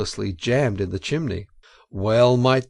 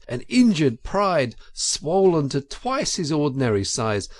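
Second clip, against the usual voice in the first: ordinary delivery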